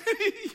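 high, forced chuckle; nervous play-along laugh; awkward fake laugh. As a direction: laugh along without hearing. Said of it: "And I'm like".

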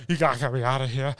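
urgent imitated begging; mock panic. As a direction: act out a frantic plea. Retold panicked man begging for rescue.

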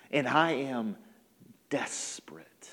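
hushed, low admission of need; desperate confession. Acted. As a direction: voice sinking to a near whisper. Desperate father confesses, voice drops.

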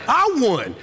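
mocking echo. Mocking the son's claim.

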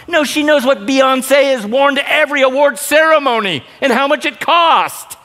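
high, loud, building outrage; heated rebuttal. Real, not acted. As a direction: fire back at the excuse.

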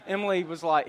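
ordinary talk

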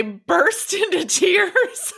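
laughing. Real, not acted. Laughing while recalling crying.

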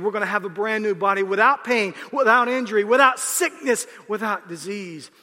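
loud, high comic preaching rant; preaching at full force. Acted. Pastor riffing to the congregation, loud and high. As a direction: belt it out like a pastor venting about aches. Preaching lift after quote.

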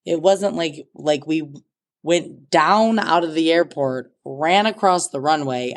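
ordinary delivery

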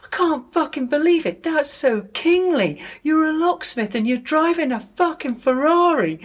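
hammed-up star who can't believe the Ferrari; [name], amazed. Noel to Gordon about his Ferrari, high excited disbelief. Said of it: "And he goes".

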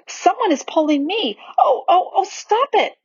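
put-on high, distressed child's protest; rising panic in a high voice; alarmed cries. A narrator's reading. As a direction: cry out as if being tugged, pitch climbing. Susan feels invisible pulling.

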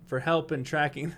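usual speaking voice

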